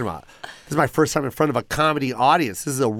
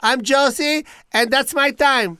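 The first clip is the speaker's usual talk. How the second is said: high comic sign-off; 11 semitones higher, slower than usual. Retold or imitated. Mom's sign-off.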